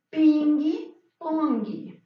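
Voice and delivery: pingui pongi said slowly, an i on each syllable end; Portuguese ping-pong. Shown as a demonstration. Teacher showing the class the Portuguese form, slow and playful. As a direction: drag out pingui pongi, adding the i. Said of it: "lo aportuguesamos y es".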